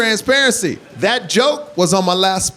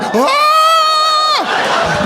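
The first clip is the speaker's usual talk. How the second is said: shocked shriek; 3 dB louder than usual. Retold or imitated. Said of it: "I'm like".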